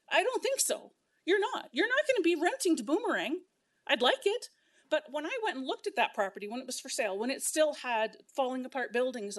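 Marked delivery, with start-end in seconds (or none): 0.1-4.5 much higher and louder, mocking disbelief; sarcastic retort. Real, not acted. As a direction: scoff at the idea, pitch up.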